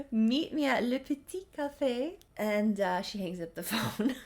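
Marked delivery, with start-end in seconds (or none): 0.1-2.1 Le Petit Café in a high, hammed-up French sing-song; over-the-top fake French flourish; mock French café name. Shown as a demonstration. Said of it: "laughs then repeats line".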